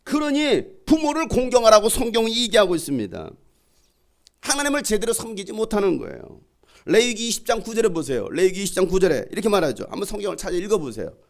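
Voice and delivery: high, insistent pulpit voice; full-force preaching. Real, not acted. Pastor rebuking those who disgrace the church, full-force preaching. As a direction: drive each sentence home to the congregation.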